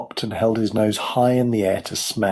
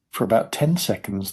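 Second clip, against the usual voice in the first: ordinary delivery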